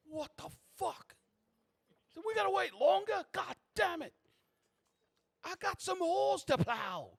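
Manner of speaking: high, loud indignant yell; outraged customers. Acted. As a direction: holler in protest at being made to wait. Waiting men protest the queue jumper.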